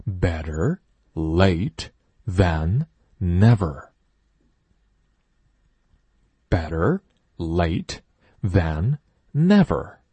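slow, even model sentence; slow teacher model sentence; slow loud reading. Shown as a demonstration. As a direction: read it slowly for the class. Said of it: "一起大声朗读".

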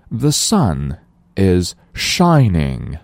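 slow, careful model sentence for learners; slow model reading. Shown as a demonstration. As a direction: read it out slowly for the class. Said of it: "我们再来大声朗读". (慢速示范朗读)

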